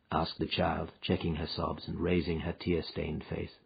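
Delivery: usual speaking voice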